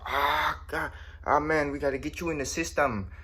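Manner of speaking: mock exasperated manager. Manager puts off worker asking for paycheck, mock exasperated. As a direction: act out a manager's hassled excuse.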